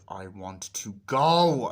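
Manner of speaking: loud, slow and drawn-out; hammed-up stubborn wail; mock demand. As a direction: imitate a kid insisting, stretching the last word. Acting Michael demanding to leave.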